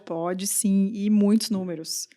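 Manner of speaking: ordinary talk, nothing marked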